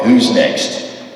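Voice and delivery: ordinary talk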